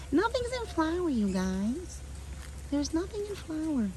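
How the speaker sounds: high, whiny complaint. Pretend. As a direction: whine it like a sulky child. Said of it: "I sound like a little whiny".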